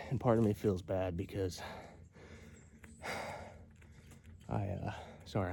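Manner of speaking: breathless after the hike; out of breath. Real, not acted. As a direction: talk while catching breath. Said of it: "I'm out of breath".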